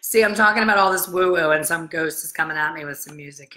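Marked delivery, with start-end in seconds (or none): none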